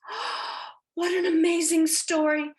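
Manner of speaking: high and loud, delighted wonder; amazed. Retold or imitated. Reacting to the woman's story, loud amazed delight. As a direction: gasp and then gush high and loud.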